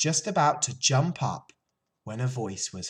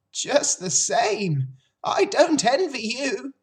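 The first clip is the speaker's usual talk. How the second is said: put-on old sheep; 7 dB louder than usual. A narrator's reading.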